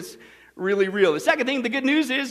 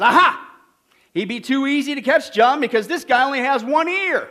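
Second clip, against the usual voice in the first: higher, louder mock eureka; triumphant trainee; 4 semitones higher, 6 dB louder than usual. Second trainee answering the policeman's test, mock triumphant. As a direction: crow it like an obvious discovery.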